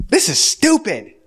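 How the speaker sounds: high, slow drawn-out gripe; slow sulky complaint. Retold or imitated. Husband griping about the dishes, slow and high. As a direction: whine it out slowly.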